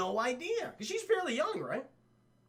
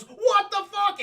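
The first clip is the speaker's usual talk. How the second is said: high, loud burst of shock; startled yell; 13 semitones higher, 10 dB louder, slower than usual. Retold or imitated. Second biker sees a gargoyle beside him, yelling high. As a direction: cry it out in surprise.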